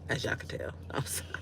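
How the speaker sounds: unvoiced giggly whisper; sheepish whispered apology; whispered aside. Real, not acted. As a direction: whisper it with a laugh.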